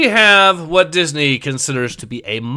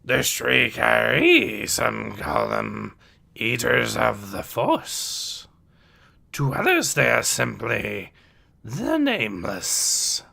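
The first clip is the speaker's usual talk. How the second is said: put-on slow old sage with pitch swoops; name the creatures slowly as the wise master; Yoda's voice; 2 semitones lower, 6 dB quieter than usual. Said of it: "I need to do the voice".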